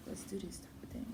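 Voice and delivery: a hushed aside, under the breath; whispering. Real, not acted. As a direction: whisper it so nobody hears.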